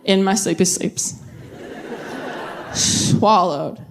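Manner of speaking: halting, sheepish confession with pauses. Retold or imitated. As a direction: confess it slowly, embarrassed.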